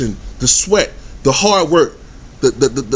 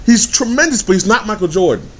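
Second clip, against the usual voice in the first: ordinary talk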